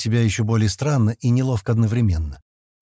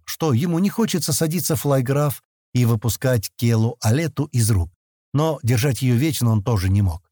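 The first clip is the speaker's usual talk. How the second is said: ordinary delivery, nothing marked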